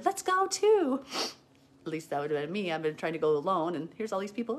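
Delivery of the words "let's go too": very high, loud, slow mock eagerness; eager tagalong crowd. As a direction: draw it out like an over-keen hanger-on. Crowd eagerly following Mary, mocked.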